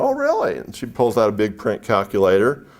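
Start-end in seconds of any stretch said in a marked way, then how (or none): 0.0-0.6 much higher delighted gasp of surprise; surprised. Retold or imitated. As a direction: squeal it like you can't believe it. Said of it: "And I go".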